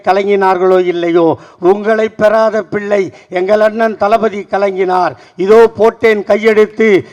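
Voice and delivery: loud rhythmic declamation of questions and answers; ringing political platform speech; rousing stump oratory. Acted. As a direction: build it like a rally litany. Formal proclamation to the crowd.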